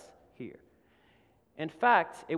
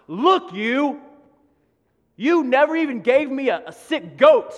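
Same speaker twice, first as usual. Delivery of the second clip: louder and higher, bitter and accusing; angry resentment; 7 semitones higher, 12 dB louder than usual. Acted. Resentful older son shouting at his father outside the party, angry. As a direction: throw the complaint in his father's face. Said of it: "Do you hear the anger?".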